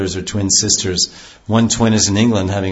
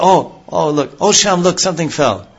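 higher, eager exclamations; excited Radharani; 7 semitones higher than usual. Retold or imitated. Radharani startled, calling Shyam to look, excited. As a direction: urgently point something out. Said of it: "And she said".

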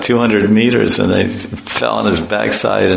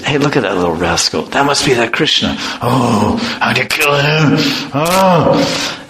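horse demon voice; 2 semitones higher than usual. Acted. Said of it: "He said".

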